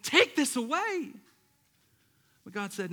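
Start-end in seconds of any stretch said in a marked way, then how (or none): 0.0-1.1 loud, slow desperate plea to God; passionate pleading. Retold or imitated. Believer pleading loudly with God to be healed. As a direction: beg God with everything. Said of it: "passionately pleaded to God".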